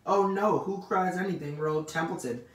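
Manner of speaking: high, loud Oh no, then easing; self-pitying sarcasm. A narrator's reading. Rat says nobody cries for him, sarcastic. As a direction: protest as if hard done by. Rat mocks, pities himself.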